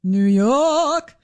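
drawn-out New York vowel in YORK; New York accent. Shown as a demonstration. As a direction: stretch out a New York "Yawk". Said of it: "Didn't get the real Linda".

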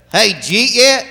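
"Jeet yet", did you eat crushed into one syllable; Southern slurred speech. Acted. Southerner asking about lunch, words slurred together. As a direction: run "Jeet yet" together as a casual question. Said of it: "you hear somebody say".

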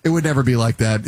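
usual speaking voice

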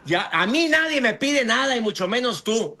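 high-pitched defiant retort; mock yelling. The last stubborn shouter answering back, mock yelling. Acting the one percent still yelling.

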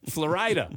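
"Florida" said flo-RIDE-a; joking mispronunciation. Pretend. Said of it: "that's, uh, where fluoride comes from".

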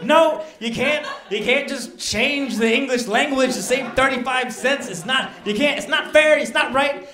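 complaining to the phone rep with indignation; outraged protest. Retold or imitated. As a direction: plead loudly that it's unfair. Arguing with Greg on phone.

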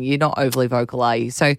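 ordinary delivery

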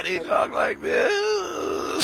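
very high, very slow squealing voice; mock a thirteen-year-old girl; teen girl impression. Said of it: "talking like a thirteen-year-old girl".

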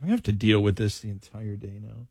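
low, quiet grumble after a pause; resigned grumble. Real, not acted. Host to co-host about the whole day ahead, resigned grumble.

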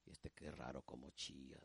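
low, hushed grumble; disapproving aside. Acted. Man watching another man cry, hushed disapproving aside. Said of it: "Otros hombres viendo a otro hombre llorar".

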